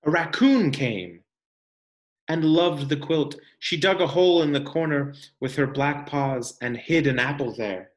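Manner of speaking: ordinary talk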